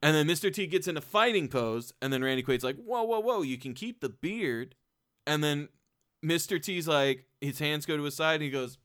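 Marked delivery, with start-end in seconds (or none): 2.8-4.8 backing off. Retold or imitated. Randy Quaid backing off.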